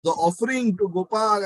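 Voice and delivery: high, drawn-out cry of dismay; retold shouting. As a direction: cry out slowly as if catching a culprit. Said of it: "you know, shouting".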